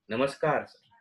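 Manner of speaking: a patient greeting the doctor, lightly voiced; patient's greeting. Shown as a demonstration. Doctor imitating a patient's greeting, lightly voiced. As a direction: namaskar as a patient walking in. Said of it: "कितना फर्क है?".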